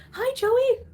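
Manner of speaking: high, loud sweet hello; bright hello to the pet; pet greeting. Real, not acted.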